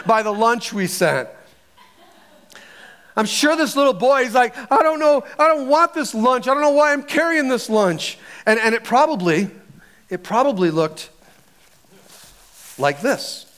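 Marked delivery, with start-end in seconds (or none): 4.7-8.2 put-on high-pitched kid's protest; whiny complaint. Embarrassed little boy stuck carrying a lunch, whiny complaint.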